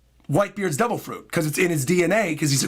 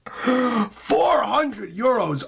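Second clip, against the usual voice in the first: very slow, tearful wail of disbelief; hammed-up sob over the money; mock crying; 4 semitones higher, much slower than usual. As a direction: streamer fake-crying at a huge donation. Overwhelmed by big donation.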